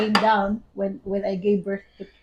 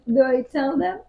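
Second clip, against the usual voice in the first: ordinary delivery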